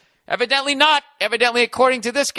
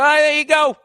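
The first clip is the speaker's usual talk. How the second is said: cheery government clerk; 7 semitones higher, 4 dB louder than usual. Retold or imitated. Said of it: "They go".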